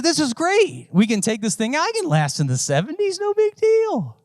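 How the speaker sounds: high, bright mock confidence, rising; over-eager optimism. Pastor mocking his own cheerful plan to pull the AC. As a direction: sell it like nothing can go wrong. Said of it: "And I said".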